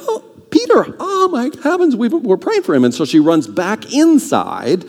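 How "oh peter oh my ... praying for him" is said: put-on very high, breathless overjoyed servant girl; overjoyed gasp. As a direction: squeal it like a thrilled girl who forgets the door. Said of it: "she's like".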